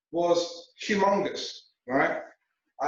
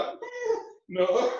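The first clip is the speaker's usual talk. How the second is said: mock refusal. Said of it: "she looked at it like".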